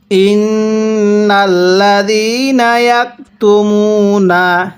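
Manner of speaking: slow, drawn-out tajweed chanting; slow Quran recitation. Shown as a demonstration. Teacher demonstrating recitation to students, slow chant. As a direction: recite each syllable long and measured. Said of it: "আমার সাথে মিলিয়ে পড়ুন".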